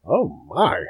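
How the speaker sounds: lightly voiced old orc, surprised; a mild funny old-man oh my. Old orc looks up at Adeline, mild funny voice. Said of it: "in that funny voice I made".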